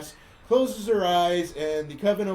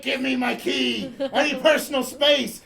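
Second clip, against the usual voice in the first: high, raised breakup demand; mock angry ex; 7 semitones higher, 4 dB louder than usual. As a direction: imitate an ex demanding distance. Imitates ex breaking up.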